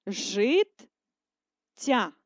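життя stretched, split between the doubled letters; second split dictation. Shown as a demonstration. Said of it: "Другий варіант переносу".

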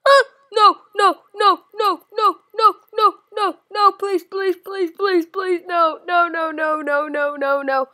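loud frantic begging no; panicked pleading. Real, not acted. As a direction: beg in real panic, voice high. Begging while being chased.

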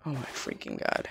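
breathless whisper of dismay. Real, not acted. Gamer whispering to herself in dread, a whisper. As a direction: breathe it out, barely voiced.

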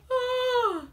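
high, swooning mock "Ah!"; a strong man keeling over; fainting cry. Strong men fainting at the giant, mock cry. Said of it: "Strong men fainted".

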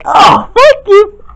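high and slow, then a yelp; the girl cries out and scolds George. Acted. Girl figure hurt by toy.

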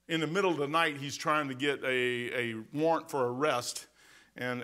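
ordinary delivery

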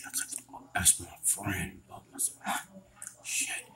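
soft, breathy worried whisper; frightened debtor whispering alone; whispering to himself. Acted. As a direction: whisper the worry to yourself.

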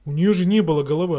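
fast, loud, rattled disbelief; shocked fast exclamation. Real, not acted. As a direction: blurt it out in shock. Shocked after jump scare.

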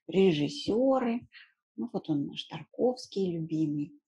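ordinary delivery

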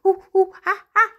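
high hooting monkey noises; monkey call. Pretend. Teacher acting out a monkey for children, high hoots. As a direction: hoot and chatter like a playful monkey.

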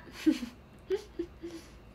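high, breathy giggle trailing off; real giggle. Real, not acted. Streamer amused by a friend's standard Japanese, giggling. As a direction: break into high-pitched giggles.

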